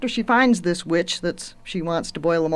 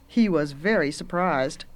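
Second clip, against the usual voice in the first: ordinary delivery, nothing marked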